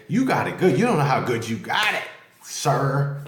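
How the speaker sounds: hammed-up envious rebuke; mock scolding viewer. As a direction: act out an indignant telling-off, with swooping emphasis. Imagined rebuke from worse-off viewers.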